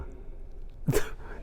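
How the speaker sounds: short breathy chuckle at own joke; quick laugh. Real, not acted. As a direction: let a small laugh slip out.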